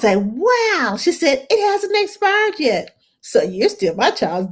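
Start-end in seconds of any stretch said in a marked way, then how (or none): 0.2-1.0 drawn-out, higher-pitched wow; retold amazement. 1.5-3.0 high-pitched imitation of a parent; retold parent's protest.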